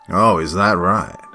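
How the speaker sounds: slow, loud, sly drawl; creepy stranger voice. Acted. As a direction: lure the kid in, slow and sly. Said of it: "the man asked".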